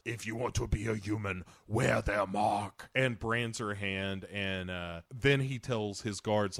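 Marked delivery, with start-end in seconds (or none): 0.0-2.9 menacing ape villain. Retold or imitated. Recapping the ape branding her hand, mock-menacing. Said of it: "and says".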